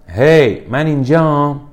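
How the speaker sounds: high, loud call to someone far off. Acted. Safety pin hails the others, calling out high and loud. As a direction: call out brightly to be noticed.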